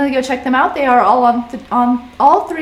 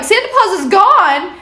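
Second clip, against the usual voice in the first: high, louder rising "gone?"; shocked disbelief; 9 semitones higher, 4 dB louder than usual. Retold or imitated. Eddie hearing Santa Paws vanished, shocked disbelief. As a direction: react like the news can't be true.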